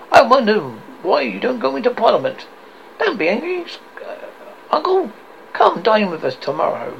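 high, lively jibe turning to invitation; jibe, then coax with a touch of cheer; lightly voiced characters. Scrooge mocks nephew, who invites him, lightly acted and high.

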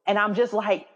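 usual speaking voice

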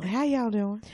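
high Southern twang, put on; Southern accent. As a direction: toss out an imitated Southern greeting. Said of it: "Oh, the South".

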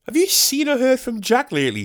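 hammed-up high-pitched wondering; imitating a puzzled listener. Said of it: "This is my impression of you".

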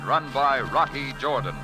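ordinary talk, nothing marked

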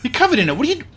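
high-pitched, rising panic; acted freak-out, speeding up; alarmed disbelief. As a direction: blurt it out in horrified alarm. Host watches guest douse himself.

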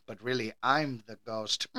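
low, soft mock-secret aside; hushed confession. Maid secretly admits she is the ghost, hushed. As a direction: drop it to a sly, quiet reveal.

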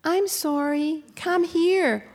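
high, rising chirpy parrot voice; little birdie voice. Retold or imitated. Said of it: "then comes the little birdie voice".

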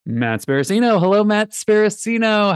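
ordinary talk

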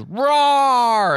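high, loud monster roar. Retold or imitated. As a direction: let out a big roar. Harry roars.

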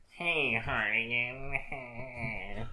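very slow, stretched-out creep; put-on voice, slow drawl; imagined villain voice. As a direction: drag it out like a movie villain. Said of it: "we don't know if he sounds like".

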